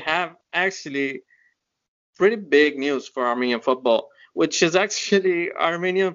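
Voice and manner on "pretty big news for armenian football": usual speaking voice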